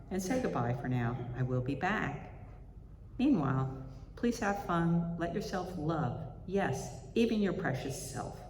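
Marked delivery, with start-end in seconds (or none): none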